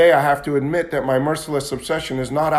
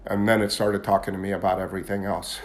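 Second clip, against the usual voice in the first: usual speaking voice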